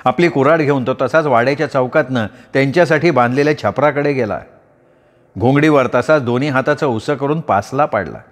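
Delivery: ordinary delivery, nothing marked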